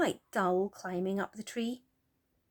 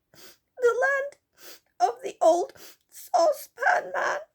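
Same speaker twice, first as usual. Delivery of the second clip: high, loud sobbing little fairy; 9 dB louder than usual. Acted. Silky sobs to the children about Moonface, high and tearful. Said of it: "said Silky".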